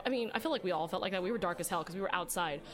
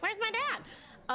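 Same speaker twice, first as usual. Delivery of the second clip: put-on little kid asking; 13 semitones higher, much slower than usual. Child self quoted.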